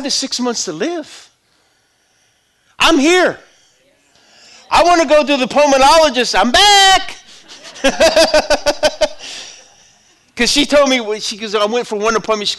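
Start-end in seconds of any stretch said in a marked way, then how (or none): 2.8-7.2 higher, louder victory cry; triumphant shout. Real, not acted. Triumphant preaching of survival. 7.5-9.5 big happy laugh; joyful laugh. Real, not acted. Laugh after triumph.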